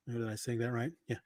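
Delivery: normal talking voice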